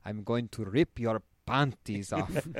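slow imitated Spanish accent; Ramon Nomar. Spanish star obliging his date's request, slow Spanish-accented impression. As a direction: draw it out in a put-on Spanish accent. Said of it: "with that Spanish shit".

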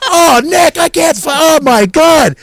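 high, loud hammed-up freak-out; mock crying wail. Imitating co-star Dale losing it on set, mock crying wail. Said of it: "He's, like, crying and waving".

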